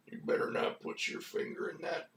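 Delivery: lightly voiced Sam Elliott, hushed and close. Old family dog warns the little kid, hushed cowboy voice. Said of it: "that's why it would be Sam Elliott".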